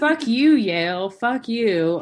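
loud mock defiance at Yale; mock fuck-you. Rejected applicant yelling back at Yale, loud mock defiance.

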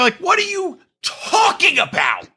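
high, slow, incredulous yell; outraged shout. Real, not acted. Hosts reacting to Kyle's rant, outraged high yell. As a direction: fling it back in disbelief.

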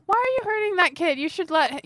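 high, hurried acted appeal; pleading reproach. Player urging the foe to release the kid, pleading reproach.

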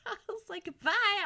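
high, loud, elated send-off; so excited. Retold or imitated. Retelling goodbye to fleeing friends, excited. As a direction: toss out a thrilled goodbye. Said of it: "I was so excited".

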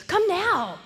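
high, eager invitation; mock over-eager welcome; excited. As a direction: urge them over with high-pitched excitement. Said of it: "they were so excited".

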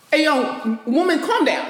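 hammed-up bossy command; misogynistic man. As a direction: imitate a man dismissing a woman. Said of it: "it's kind of misogynistic to be like".

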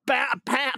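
high, punchy banging noise; sharp spoken thumps; imitated door pounding. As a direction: mimic fists hitting a door.

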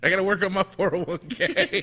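loud, high, hammed-up parent voice; bossy parent. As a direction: holler it as a comic excuse. Same joking parent voice.

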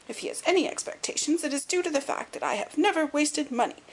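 high, indignant miser's tirade; screaming little old man. A narrator's reading. Old lord refusing responsibility to the detective, querulous high tirade. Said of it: "screamed the little man".

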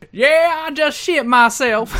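high, loud mock Southern accent; Dixie Carter. Cohost slips into promoter's voice, loud mock Southern. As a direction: holler it with an exaggerated Southern twang. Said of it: "I got a little Dixie then".

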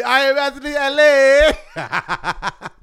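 high, loud, drawn-out mock announcer yell; big playful holler ending in a laugh; hyped show shout. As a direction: stretch it out like a hype man. Hyping the show's L A trip.

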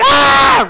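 short loud high mock scream; hammed-up shriek of panic. Gelatophobic fleeing the room, mock scream. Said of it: "Run out of the room screaming".

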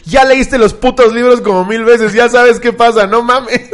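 exasperated yell, high, loud and drawn out; angry retort. Retold or imitated. Fed-up fan turning on the wailing girl, angry yell. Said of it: "Me enojé tanto que me volteé y le dije".

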